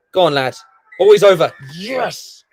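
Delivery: loud excited yell; cheering shout. Real, not acted. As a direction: shout him over the line. Cheering on a player.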